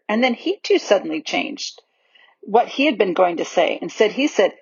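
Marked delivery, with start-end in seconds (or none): none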